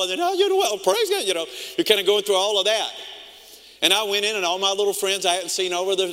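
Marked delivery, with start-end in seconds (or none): 0.2-1.2 put-on squeaky cracking teen voice, much higher. Shown as a demonstration. Preacher imitating a teenage boy whose voice breaks, squeaky cracking voice. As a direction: jump up into a cracking falsetto. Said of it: "talking like this".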